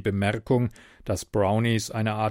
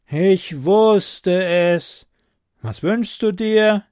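higher, louder goblin, very slow start; 7 semitones higher, 8 dB louder, much slower than usual. A narrator's reading. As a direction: sigh it like a long-suffering goblin. Goblin resigned about wishes.

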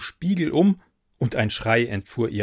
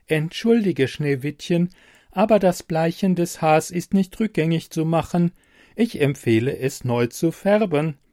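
lightly voiced smart computer, a touch higher; a helpful machine, evenly spoken; 6 semitones higher, 4 dB louder than usual. Computer voice in story.

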